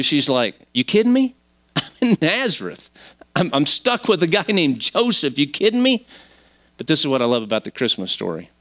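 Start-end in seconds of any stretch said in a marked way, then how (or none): 0.7-6.1 high-pitched comic disbelief; mock-baffled protest, voice jumping up; incredulous. Mary answering the angel from Nazareth, comically incredulous. Mary's imagined reply to the angel.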